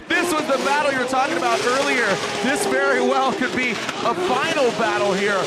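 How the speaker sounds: high, fast race call; excited play-by-play. Acted. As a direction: call the battle at full pitch. Live play-by-play of battle.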